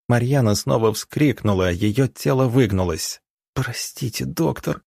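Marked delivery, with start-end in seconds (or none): 3.5-4.8 weak, higher-pitched strained voice; pained, quiet croaking. A narrator's reading. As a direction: softly force it out through clenched teeth. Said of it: "прохрипела она, стиснув зубы".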